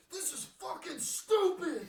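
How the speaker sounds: slow, very high, loud yell; yelled at top of lungs. Retold or imitated. Yelling at the ground on a dare in the schoolyard, loud and high. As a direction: scream it as loud as you can. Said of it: "I yelled at the top of my lungs".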